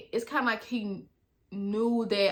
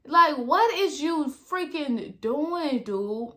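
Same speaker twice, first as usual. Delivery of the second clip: exasperated; 5 semitones higher, slower than usual. Real, not acted. Exasperated reaction to his voice.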